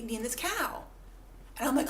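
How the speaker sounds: ordinary talk, nothing marked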